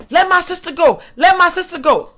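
retold holler, not at full volume; mild mock yell. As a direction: imitate a sister calling out to free her sibling. Imitating sister demanding his release.

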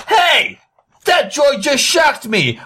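high-pitched startled yell; outraged cry. Acted. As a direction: cry out as if just zapped. Acted outcry after being shocked.

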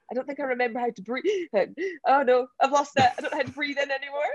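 high, fast mock panic, laughing; hammed-up breathless alarm. Retelling her pre-race panic to the host, mock panic.

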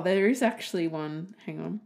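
usual speaking voice

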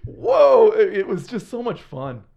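real joy spilling over into laughter and a catch of tears; laughing, emotional. Real, not acted. Telling a friend about his mission, laughing and near tears. Overwhelmed recalling mission successes.

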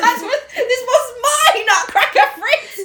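high, slow, laughing mock reproach; laughing mock scold. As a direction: scold Fritz through laughter. Marie scolding Fritz, laughing.

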